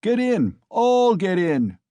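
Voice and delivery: loud, high, slow bellow; drunken cry. A narrator's reading. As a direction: roar the invitation. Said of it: "cried Mikolka".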